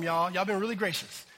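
ordinary talk

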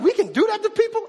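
very high, aghast question; shocked. Acted. A crowd member stunned by the flogging, high and aghast. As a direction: gasp it out in disbelief.

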